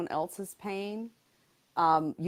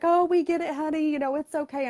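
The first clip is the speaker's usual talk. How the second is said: much higher, louder, soothing nurse; sweet nurse voice; 10 semitones higher, 6 dB louder than usual. Retold or imitated. Chemo nurse sweetly reassuring an angry patient. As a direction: coo it like a kindly nurse calming a patient. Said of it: "but she's like".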